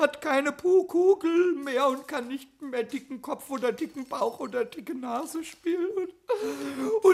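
tearful goblin whimper, slow and drawn out; whining lament. Acted. Goblin lamenting his broken bauble to the carpenter in tears. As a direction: sob out the woes of a self-pitying child. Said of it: "hör auf mit der Jammerei".

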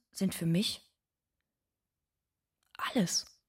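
a soft whispered last word; breathing the word out tenderly. Acted. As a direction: let the word fall away to a whisper. Tender confession about children.

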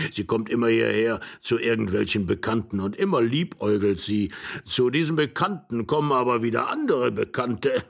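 put-on doddering old voice with chuckles; chuckling old man. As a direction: gossip with an old man's cackling relish.

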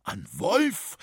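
astonished question, swooping up in pitch; taken-aback echo question; surprised. A narrator's reading. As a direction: ask it in sheer disbelief. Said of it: "fragte Franz überrascht".

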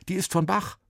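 put-on a boy insisting, a bit unsure; Sven's voice. A narrator's reading. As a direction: Sven explaining himself. Sven voiced in story.

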